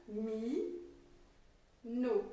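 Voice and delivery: MI, then a long pause, then NO; syllable by syllable. Shown as a demonstration. Teacher shows that each stopped sound is a syllable, syllable by syllable. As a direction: separate "Mi, no" into two stopped sounds. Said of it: "chaque fois que je fais un son qui s'arrête".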